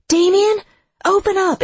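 high, loud desperate shout; screaming. A narrator's reading. Lex bangs on Damien's door, screaming. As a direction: scream to get through a closed door. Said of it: "Maybe I shouldn't scream".

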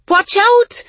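high, loud shout of alarm; yelled warning. A narrator's reading. Hyacinth warning her sister as the dog charges, yelling. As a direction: yell an urgent warning. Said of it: "Hyacinth yelled at Isa".

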